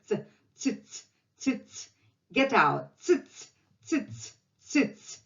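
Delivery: ц, ц said as a sharp ts; ts sound drill. Shown as a demonstration. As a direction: repeat ц, ц quickly. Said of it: "something that doesn't make any sense".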